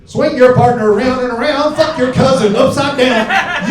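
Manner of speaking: square dance caller. Retold or imitated. Comic mimics a DJ calling a square dance. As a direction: call it like a country dance caller. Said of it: "and I'll go".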